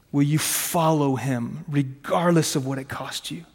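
usual speaking voice

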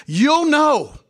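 high and loud, ringing out; a sudden high, loud declaration; emphatic preaching shout. Real, not acted. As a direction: cry it out to the congregation. Preacher driving point home.

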